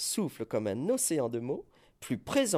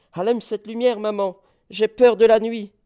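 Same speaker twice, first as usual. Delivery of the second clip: much higher, loud panicked plea; frightened child's cry; 9 semitones higher, 14 dB louder than usual. Acted. Child begging mother for light, loud and frightened. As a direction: cry out to mama like a scared child.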